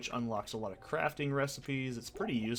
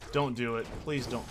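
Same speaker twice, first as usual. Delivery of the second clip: ordinary talk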